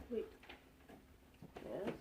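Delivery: usual speaking voice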